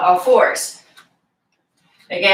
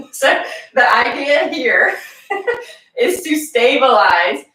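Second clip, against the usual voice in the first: laughing retake; 4 semitones higher than usual. Real, not acted. Instructor redoes the cue, laughing off her Southern accent. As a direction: redo the phrase in her own voice, still laughing. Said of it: "that sounded like a very thick Southern accent".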